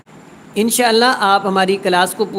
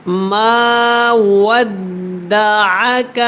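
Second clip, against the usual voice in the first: slow, melodic tilawat, each word drawn out; measured, sung-out Quranic recitation; 2 semitones higher, 3 dB louder, much slower than usual. Shown as a demonstration. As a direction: chant the verse slowly and melodically. Teacher recites Quran verses.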